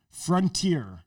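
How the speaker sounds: "Frontier" as frun-teer, falling; FRUN-tier pronunciation. Retold or imitated. Showing the friends their emphasis, "Frontier" as frun-teer. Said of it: "you're saying front".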